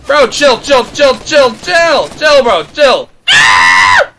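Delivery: frantic loud shouts; yelling. Real, not acted. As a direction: yell at him to back off. Yelling at enemy player.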